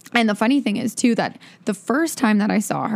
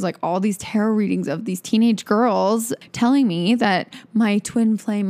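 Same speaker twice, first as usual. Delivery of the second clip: usual speaking voice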